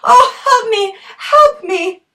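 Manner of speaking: high loud pleading shout; desperate cry for help. Acted. Girl being swallowed by snake.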